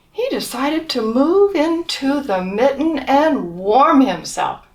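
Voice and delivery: put-on lively read-aloud for children; storybook reading. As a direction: tell it like a bedtime picture book. Reading picture book to children.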